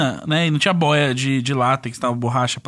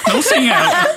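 high, louder melodramatic cry; dramatic cry; 11 semitones higher, 4 dB louder than usual. Retold or imitated. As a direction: wail it like a movie farewell. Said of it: "falou assim".

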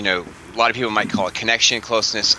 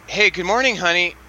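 put-on high-pitched morning greeting to a teen; cheery parent; 8 semitones higher than usual. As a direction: sing out a sweet parental hello. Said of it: "I'm gonna do some acting right now".

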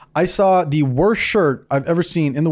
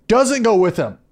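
usual speaking voice

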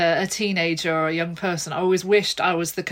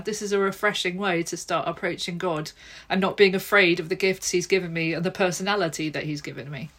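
normal talking voice

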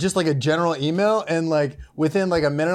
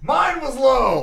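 drawn-out shout; 8 semitones higher, much slower than usual. Real, not acted. Shouted confession.

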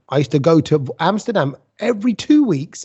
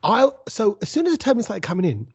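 ordinary delivery

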